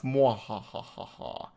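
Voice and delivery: soft, slow mock villain cackle; a quiet hammed-up Mandark laugh; evil Joker laugh. Retelling the guys walking away, mock evil laugh. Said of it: "doing an evil Joker laugh".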